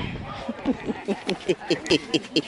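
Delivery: long low chuckling giggle; tickled laughing fit. Real, not acted. Host tickled among her guests, long giggling fit. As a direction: get tickled and keep giggling helplessly. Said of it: "And I done got tickled".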